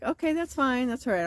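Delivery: high, polite it's fine; high breezy reassurance. Retold or imitated. To the camp host with no spray, high breezy reassurance. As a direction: brush it off sweetly.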